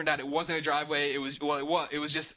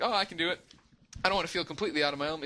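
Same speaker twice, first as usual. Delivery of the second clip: self quoted; 2 semitones higher, much faster than usual. Retold or imitated. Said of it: "I'm like".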